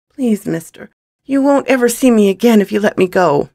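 put-on high, urgent little boy voice; pleading boy. Young thief begging the gunman to let him go, pleading. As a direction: beg for release in a high child's voice.

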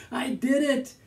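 high, laughing with triumph; laughing, excited. Real, not acted. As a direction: cheer through the laughter. Solves the puzzle, delighted.

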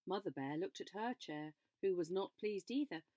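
plain reading voice

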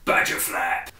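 low hushed product name reveal; low dramatic advert tagline; ad slogan. Acted. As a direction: announce the brand like a spoof advert.

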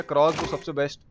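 normal talking voice

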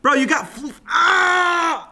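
high, loud yelp; shouting as the dog lunges; startled shout. Real, not acted. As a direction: cry out in alarm. Dog grabs food, yelling.

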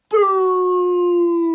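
huge shouted boo, high and loud. Acted. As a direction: blast it out to startle the herd. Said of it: "at the top of his voice shouted".

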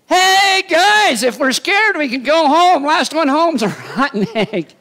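high, loud, playful call to the troops; God hamming it up, daring them with a laugh; mock cheerful God. God teasing Gideon's scared army, high and loud with a laugh. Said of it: "God's like".

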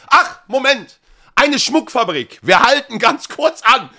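high, loud, slow guide voice fake-surprised by a stop; fake-surprised tour guide. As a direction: sell the detour as a happy accident.

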